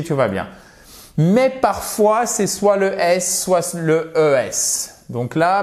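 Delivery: ordinary talk, nothing marked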